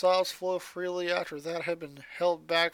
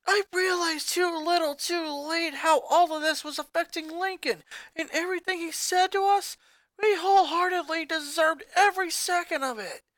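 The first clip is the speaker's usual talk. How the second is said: fighting back tears; 8 semitones higher than usual. Acted. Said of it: "Luna was fighting back tears".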